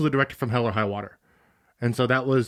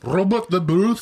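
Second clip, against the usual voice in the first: put-on Scottish accent; 3 semitones higher, slower than usual. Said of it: "say a sentence in a Scottish accent".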